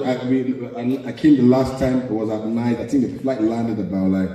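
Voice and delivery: normal talking voice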